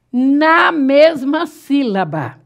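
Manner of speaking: "na mesma sílaba" drawn out slowly, each word weighted; slow right down on "na mesma sílaba" so it sticks; slow stressed phrase. Shown as a demonstration. Teacher driving home the rule to the class, slow and stressed.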